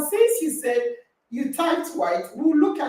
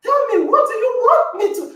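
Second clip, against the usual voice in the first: laughing plea; 5 semitones higher, 3 dB louder, faster than usual. Retold or imitated. Retelling pleading question to Rachel.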